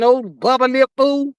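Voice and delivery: mock insult. Real, not acted. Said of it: "I said".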